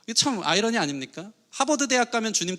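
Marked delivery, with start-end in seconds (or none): none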